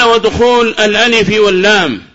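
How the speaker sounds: high, loud formal text reading; reciting the text. Shown as a demonstration. Teacher quoting the next sign from the text, formal recitation.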